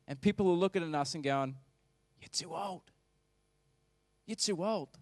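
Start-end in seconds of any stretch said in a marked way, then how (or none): 2.2-5.0 softer, jeering; mock scoffing onlookers. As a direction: sneer at the old couple. People mocking the old couple.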